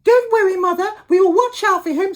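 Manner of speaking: squeaky cubs reassuring their mother together; little wolves promise their mother, very high and loud. Acted. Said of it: "said the three little wolves".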